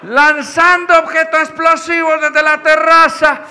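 high, loud, slow public-address voice; the neighborhood council megaphone addressing the mothers, loud and slow; megaphone announcement. Retold or imitated. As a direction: announce it slowly over the loudspeaker. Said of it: "tenía un megáfono conectado".

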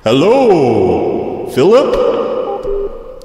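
slow, drawn-out put-on supervisor voice. Fake supervisor takes over the prank call, slow put-on voice. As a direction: stretch each phrase out as a silly supervisor. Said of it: "this is the supervisor".

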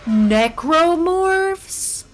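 surprised. Real, not acted. Surprised at name.

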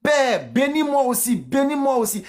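high, pleading son; hammed-up cry of bitterness; acted bitter cry. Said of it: "Regardez comment Esau crie d'amertume".

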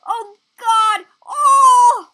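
high, loud panicked cries; panicked shout. Real, not acted. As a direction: yell at a close call. Close call in game.

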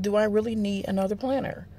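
soft, musing inner voice; thinking to oneself. Retold or imitated. Vlogger retelling her thought during a movie, musing softly. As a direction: ponder it softly, as a private thought. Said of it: "I'm thinking to myself".

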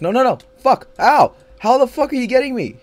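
high, loud, frantic yelling in pain; panicked screaming. Real, not acted. Gamer screaming as the enemy keeps hitting him. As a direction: scream like you're being hunted down. Hit while being chased, screaming.

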